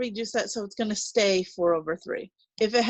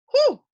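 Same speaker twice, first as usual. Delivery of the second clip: a big high whistling whew, loud; mock daunted whew; 9 dB louder than usual. Teacher faces a scary-looking exponent problem, hammed-up whew. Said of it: "this looks very intimidating".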